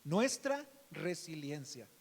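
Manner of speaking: slow, with "nuestra" leaned on hard; preacher underlining the word "nuestra" slowly; stress on nuestra. Shown as a demonstration. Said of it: "remarcar es la palabra nuestra".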